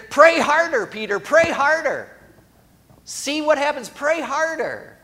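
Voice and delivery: high, loud hammed-up nagging preacher; mocking the advice people shout at the drowning; mock preachy scold. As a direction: bark it like a pushy know-it-all preacher. Preachy scold voiced for Jesus.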